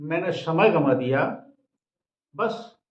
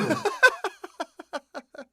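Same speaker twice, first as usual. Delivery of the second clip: amused laugh; 12 semitones higher than usual. Real, not acted. Laughs at own punchline.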